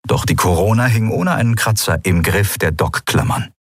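ordinary delivery, nothing marked

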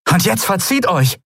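high, forceful dismissal; acted high-pitched command. A narrator's reading. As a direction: order them off. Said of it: "befahl Endriel".

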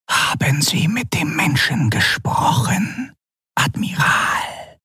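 eerie half-whisper, drawn out at the end; questioning Excellency. A narrator's reading. Ruler questioning the admiral about the human, eerie whisper. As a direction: question him in a voiceless, commanding rasp.

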